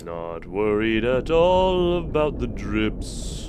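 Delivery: slow, dry sarcastic drawl. Real, not acted. Player mocking the leaking sub, slow and sarcastic. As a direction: say it slowly, meaning the opposite.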